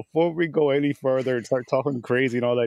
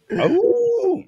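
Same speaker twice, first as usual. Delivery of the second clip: wolf howl; 7 dB louder than usual. Acted. Second howl-like call.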